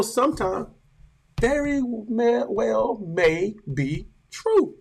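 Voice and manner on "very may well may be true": ordinary delivery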